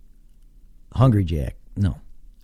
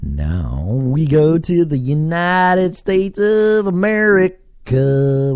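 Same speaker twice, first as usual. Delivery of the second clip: high, slow build-up announcement; hammed-up drawn-out proclamation; grand announcer; 7 semitones higher, 3 dB louder, slower than usual. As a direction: stretch it out like a ring announcer. Grand announcement of next country.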